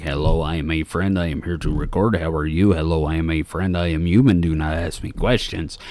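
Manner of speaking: mock alien pretending to be human, deadpan and low. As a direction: play a fake human reciting lines.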